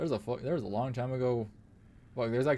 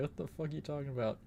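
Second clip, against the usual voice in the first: ordinary talk, nothing marked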